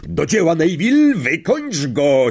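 loud, high rallying call; teacher's spirited shout of encouragement. A narrator's reading. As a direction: urge the pupil into action. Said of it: "zawołał Lapin".

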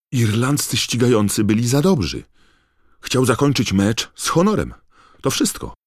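high, loud, heated defence; shouting back. A narrator's reading. Harry defends Krum to his friend, shouting back. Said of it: "odkrzyknął Harry".

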